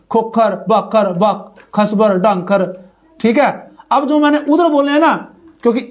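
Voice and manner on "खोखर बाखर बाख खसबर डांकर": खोखर, बाखर, खसबर, डांकर said slowly, each ending on r; slow careful demo of खोखर and डांकर with no e; without the final e. Shown as a demonstration. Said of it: "चलो मैं ऐसे बोल देता हूँ".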